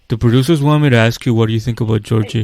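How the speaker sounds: hurried imitation of a middle schooler; mock middle-school line reading. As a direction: rattle it off like a scripted kid. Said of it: "he really acted just like a, just like a middle schooler".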